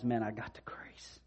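a soft, amazed aside; whispered aside. Retold or imitated. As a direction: whisper it like sharing a secret. Paul paraphrased casually.

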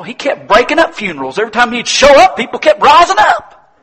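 high, loud, building to a shout; full-force preaching. Real, not acted. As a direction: preach it with mounting exuberance.

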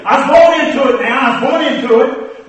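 a hint of a smug preacher; mock preacher testimony. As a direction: parody another preacher's boast. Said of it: "some will say".

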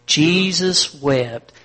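each word drawn out slowly and loudly; slow, loud group recital. Shown as a demonstration. Preacher leading the church in reciting, slow and loud. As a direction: lead the room through it, slow and loud. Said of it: "One more time".

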